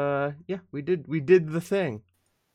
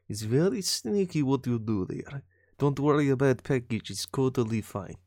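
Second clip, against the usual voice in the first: low, measured put-on Eastern European accent; shady comrade handler; 5 semitones lower than usual. Shady boss briefing a courier on London deliveries, in mock comrade accent. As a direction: play a sneaky foreign courier boss.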